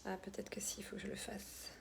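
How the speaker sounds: very soft whispered aside; muttering to oneself. Real, not acted. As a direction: whisper it under your breath, to yourself. Muttering to herself while fiddling with settings.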